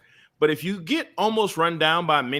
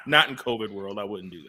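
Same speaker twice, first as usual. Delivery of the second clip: ordinary talk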